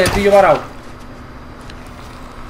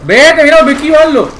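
suddenly louder and higher; excited exclamation; 5 semitones higher, 6 dB louder than usual. Real, not acted. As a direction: burst out mid-fight. Exclama durante el combate. (exclamación fuerte y aguda)

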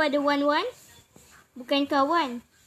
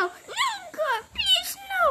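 mock desperate plea; 13 semitones higher, 5 dB quieter, much slower than usual. Mock pleading in game.

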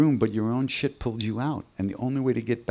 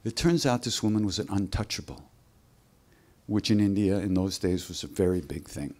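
usual speaking voice

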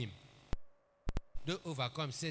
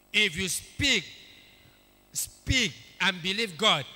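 preaching emphasis; 3 semitones higher, 8 dB louder, slower than usual. Acted. Preacher urging loudly.

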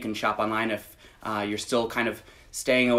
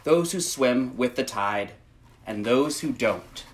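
gentle, measured picture-book read; reading to children; 2 semitones higher, slower than usual. Acted. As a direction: read it slowly to little listeners. Reads picture book aloud.

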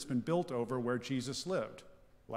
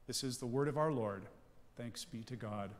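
usual speaking voice

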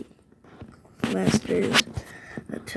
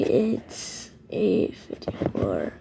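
hoarse near-whisper; breathy, voice almost gone; raspy whisper; 3 semitones lower, 5 dB quieter, slower than usual. Real, not acted. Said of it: "that's why I have low voice".

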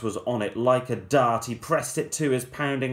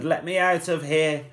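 ordinary delivery

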